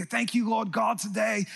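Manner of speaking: badly faked British worship-singer accent; terrible British accent. Preacher mimics British worship leaders on CDs, terrible British accent. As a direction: pray it in a hammy, bad British accent. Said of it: "they had this British accent".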